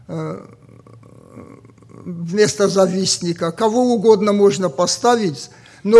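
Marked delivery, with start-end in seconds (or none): none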